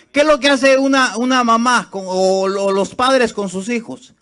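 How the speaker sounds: high, loud preaching cry; fervent loud preaching; full-force preaching. Acted. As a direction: preach it out to the whole church.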